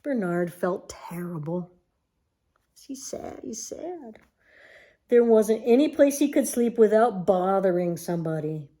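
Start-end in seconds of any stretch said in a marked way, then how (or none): 2.8-4.2 quiet pitying murmur; soft aside. Real, not acted.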